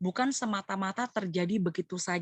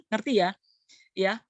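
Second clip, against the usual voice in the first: ordinary talk, nothing marked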